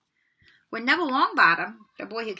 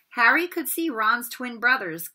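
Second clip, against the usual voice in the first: plain reading voice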